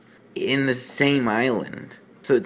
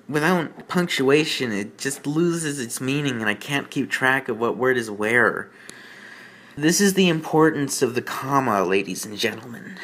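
ordinary talk

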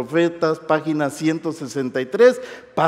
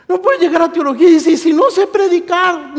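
high, loud preacher's outcry; loud mock excuse; 12 semitones higher, 7 dB louder than usual. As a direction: cry it out high and loud to the hall. Preacher mocks students' excuses, loudly.

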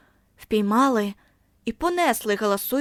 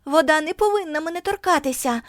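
higher, pleading cry; mild cry; 6 semitones higher than usual. A narrator's reading. Girl refusing the school shower, higher pleading cry. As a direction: insist against the water, rising. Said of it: "вона кричала".

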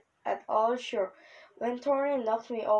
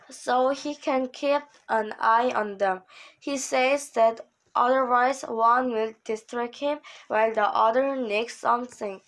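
ordinary delivery

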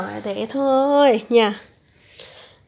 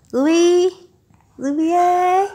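calling out; 7 semitones higher, 6 dB louder than usual. Real, not acted. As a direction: call her name brightly across the room. Mother calling baby's name.

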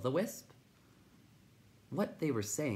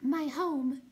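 very high, tiny voice; hesitant creature; 4 dB louder than usual. A narrator's reading. Will-o'-the-Wisp begins its tale, hesitant high voice. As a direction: begin in a small high pipe. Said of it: "after a moment's hesitation".

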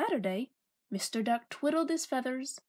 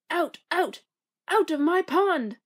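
louder, higher furious yell; angry shout; 4 semitones higher, 7 dB louder than usual. Acted. Mister Duck shouting the animals out of his pond, furious. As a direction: drive them out of the pond. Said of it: "cried Mister Duck".

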